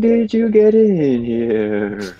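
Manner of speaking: high, very slow moaning voice; zombie moan. Acted. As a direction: draw it out like a zombie. Player voices character in zombie style.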